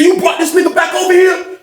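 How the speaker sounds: high-pitched imitation of an indignant inmate; retold outraged question. Old head's outraged question, retold.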